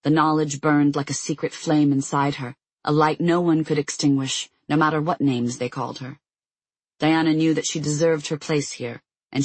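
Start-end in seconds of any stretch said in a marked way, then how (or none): none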